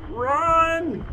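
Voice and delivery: high yelp; panicked cry. Real, not acted. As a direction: yelp it as you flee. Fleeing the boss.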